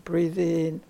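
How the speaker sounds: normal talking voice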